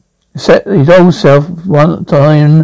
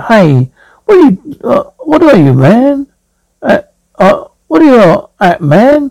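high, flustered, stammering questions; flustered Zaphod; 7 semitones higher than usual. Acted. Zaphod stammering at his great-grandfather, high and flustered. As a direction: splutter at the old ghost in disbelief.

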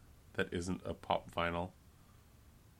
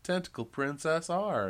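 TV announcer; 7 semitones higher, 4 dB louder than usual. Pretend. Mock TV channel announcement.

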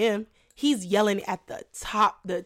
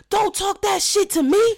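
flipped-out yell; 10 semitones higher, 4 dB louder than usual. Retold or imitated. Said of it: "he just flipped the wig".

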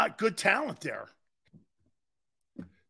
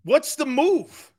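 slow and loud, leaning on move; louder, slow emphatic repeat; 6 semitones higher, 5 dB louder, slower than usual. Shown as a demonstration. Host repeating the question to get an answer, louder and slower. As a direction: punch the question out harder.

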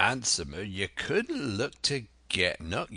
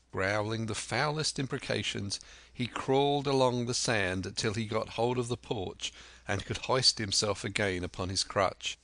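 ordinary delivery

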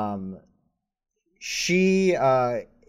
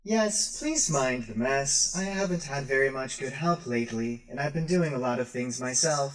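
high, soft droid voice; a gentle robot apologizing for clutter; sweet robot shopkeeper; 4 semitones higher, 3 dB quieter than usual. Acted. Rosie the droid speaks.

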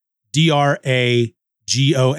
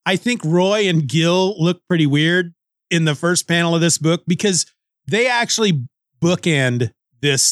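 ordinary talk, nothing marked

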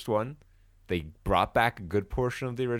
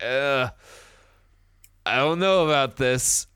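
skeptical, a bit louder ugh, I don't know; doubtful groan; 5 semitones higher, 5 dB louder than usual. Retold or imitated. Watching the second trailer, groaning with doubt. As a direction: let the doubt sag out on ugh. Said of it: "I was like".

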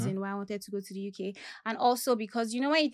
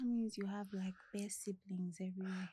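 whispering; 14 dB quieter than usual. Real, not acted. Aside about many siblings.

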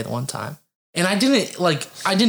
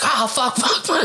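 mock scream; 9 semitones higher than usual. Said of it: "he starts screaming".

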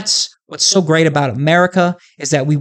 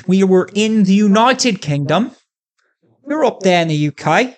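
higher-pitched, badly faked British accent; attempted British accent; 5 semitones higher than usual. Shown as a demonstration. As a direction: try out a British accent, hammed up. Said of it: "used to have a really decent British accent".

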